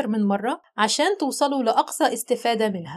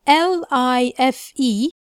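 spelling out slowly; much slower than usual. Shown as a demonstration. Teacher spelling the word slowly. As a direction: spell L I F E letter by letter. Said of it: "يبقى".

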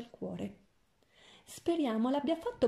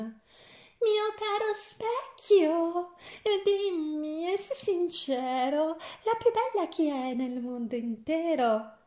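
much higher, louder, very slow shrill call; shrieking queen; 7 semitones higher, 3 dB louder, slower than usual. A narrator's reading. Queen demanding the magic mirror call her fairest, shrieking. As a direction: screech it up at the mirror. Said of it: "gli chiedeva strillando la sovrana".

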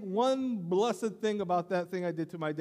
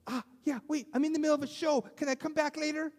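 distracted daughter; 10 semitones higher than usual. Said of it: "the other one was worse".

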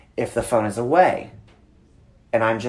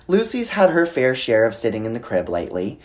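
ordinary talk, nothing marked

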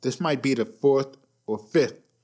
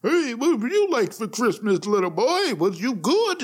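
higher, cheesy mall Santa; 7 semitones higher than usual. Retold or imitated. Mall Santa asking a little boy, higher cheesy voice. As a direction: play a jolly mall Santa greeting a kid. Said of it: "Santa taking pictures".

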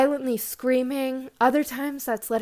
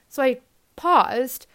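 ordinary talk, nothing marked

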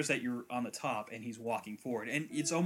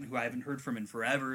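normal talking voice

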